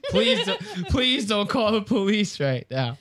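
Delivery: high, laughing plea; mock panicked pleading; pleading Joey. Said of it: "Joey's like".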